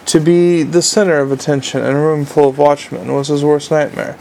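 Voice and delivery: plain reading voice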